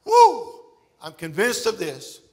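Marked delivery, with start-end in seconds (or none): 0.0-0.6 high, loud joyful whoop; sudden exultant woo; excited woo. Real, not acted. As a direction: let out a happy preacher's whoop. Excited shout in sermon.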